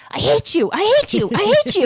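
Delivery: high, hammed-up "I hate you" in rising pitch; half-joking furious chant; mock rage at the radio. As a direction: vent at him like a driver fuming in the car. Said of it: "I was just like".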